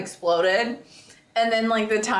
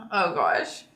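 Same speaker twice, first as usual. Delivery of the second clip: normal talking voice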